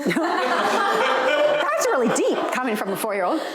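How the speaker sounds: slow, laughing through the words. Real, not acted. Uncle marvelling at his niece, laughing. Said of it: "laughing remark".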